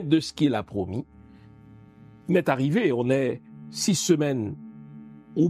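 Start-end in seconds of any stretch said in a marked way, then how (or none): none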